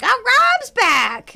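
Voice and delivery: very high, loud, slow delighted squeal; Ophelia voice. Pretend. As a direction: stretch it out with delight. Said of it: "Ophelia's like".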